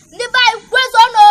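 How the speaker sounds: high, loud call to the kin; rallying shout. Real, not acted. Elder greeting his people, loud rallying shout. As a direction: rouse the whole crowd.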